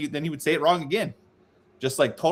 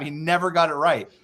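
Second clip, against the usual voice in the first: ordinary delivery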